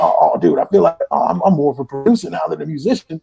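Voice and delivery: raspy musician buddy impression. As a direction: imitate a friend's raspy, laid-back "oh, dude" voice. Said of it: "[name]'s raspy voice, my good [name] impression".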